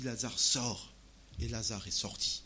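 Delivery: usual speaking voice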